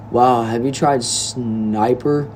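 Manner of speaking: ordinary talk, nothing marked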